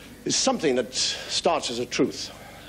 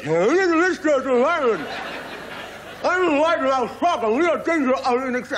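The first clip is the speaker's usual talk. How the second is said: very high, stretched wide-mouth frog voice; 14 semitones higher, 3 dB louder than usual. Acted. Frog spokesman pleading with Ian Smith, in a high wide-mouthed voice. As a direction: plead like a pompous little frog with a huge mouth.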